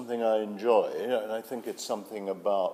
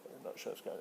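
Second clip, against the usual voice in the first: unsure casting people, imitated in a whisper; 4 semitones higher, 13 dB quieter than usual. Mocking casting panel's doubt in a whisper. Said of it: "they're all going".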